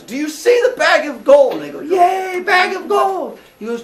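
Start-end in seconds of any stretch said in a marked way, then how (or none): 0.0-1.5 loud question. Retold or imitated. Aesop quizzing crowd loudly. 1.8-3.4 high, loud silly cheer. Retold or imitated.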